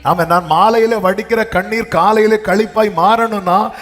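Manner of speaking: high, driving preacher's voice; impassioned preaching. Acted. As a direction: build urgently to a call for amens. Preaches at a climax. (ஆவேசமான பிரசங்கம்)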